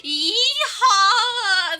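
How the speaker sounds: loud, hammed-up Southern cowgirl holler; Southern cowgirl accent. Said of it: "did you have that Southern cowgirl accent".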